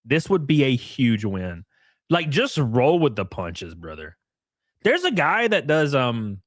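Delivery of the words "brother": ordinary delivery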